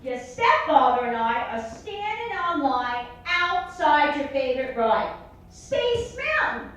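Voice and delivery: mock gloating mom; mother bragging. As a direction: drawl it out proudly. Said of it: "She goes".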